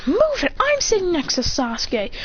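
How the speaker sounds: put-on high-pitched girl voice; pushy demand. Sakura shoving in beside her crush, high pushy demand. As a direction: claim the seat in a high, bossy tone. Girls quarrel over seat.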